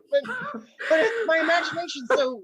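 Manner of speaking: high, laughing mock protest. Host defending her imagination, high and laughing. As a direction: whine it through laughter. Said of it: "I'm like".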